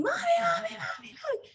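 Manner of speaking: put-on high childlike mommy call; kids calling mommy. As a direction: imitate small kids whining for mommy. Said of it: "my little kids come in here and they're screaming".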